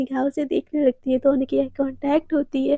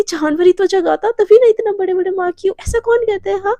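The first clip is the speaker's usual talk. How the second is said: higher, louder accusing retort; acted indignant scolding; 5 semitones higher, 5 dB louder than usual. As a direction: scold your partner over the evidence. Devika berates partner over marks.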